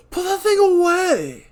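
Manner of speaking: high, loud and slow; shouting. Acted. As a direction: yell it in alarm. Dan voiced, shouting.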